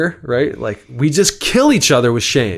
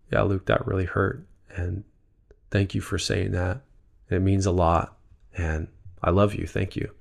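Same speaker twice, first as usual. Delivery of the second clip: much softer, gentle and sincere; tender reply; 2 semitones lower, 7 dB quieter than usual. Pretend. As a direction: speak softly as a touched friend. Friend answering owned apology.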